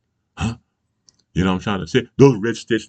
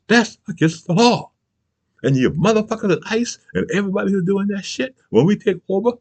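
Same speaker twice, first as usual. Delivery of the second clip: heated rant; 12 semitones higher than usual. Real, not acted. Ranting about ICE.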